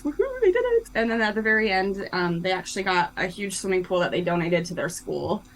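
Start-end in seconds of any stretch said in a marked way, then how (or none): 0.0-0.9 high, bright cheer for the win; cheerful woohoo. Real, not acted. Author cheering her heroes' success to the host, excited. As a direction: celebrate the happy ending. Cheering the story's happy ending.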